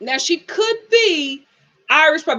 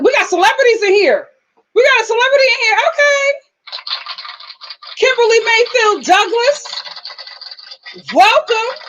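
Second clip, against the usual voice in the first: high, loud celebrity welcome; excited hype; 10 semitones higher, 3 dB louder than usual. Real, not acted. Host greeting a celebrity's descendant in the chat, excited hype. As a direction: announce the guest like a star has walked in.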